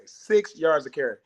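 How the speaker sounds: Six yards a carry spaced out slowly word by word; slow, deliberate Six yards a carry; slow, pointed repeat. Real, not acted. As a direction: let each word land. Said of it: "That's all I gotta say".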